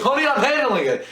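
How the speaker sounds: high, insistent reply to a partner; mock exasperated reassurance. Acts out man answering his partner.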